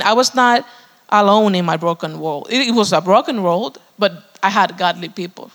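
normal talking voice

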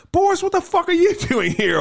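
high-pitched mock outburst; retold exasperated demand. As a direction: act out someone thrown by an unwelcome visitor. Retold angry challenge to Boris.

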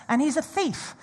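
normal talking voice

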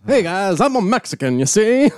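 loud, high cartoonish Mexican accent; hammed-up Mexican accent. Hammy ethnic stage caricature.

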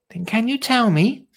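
high-pitched pleading question; Lottie's child voice. Acted. Lottie wants Dottie's secret, high child-character voice. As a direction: ask high, as an eager child. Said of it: "said Lottie".

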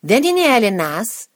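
retold shout to get through; shouting over din. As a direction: shout it over a noisy room. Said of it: "start shouting over the din".

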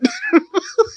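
high giggle. Real, not acted. Laughs about still being in the skit.

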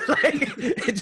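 laughing through the words. Real, not acted. Teacher laughing while recalling a student who missed the joke. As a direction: break into laughter mid-story.

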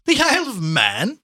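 high, loud, drawn-out incredulous echo; amused scorn. Acted. Gallagher laughs at Chandler's little trip, amused scorn. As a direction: repeat it as a mocking question, rising.

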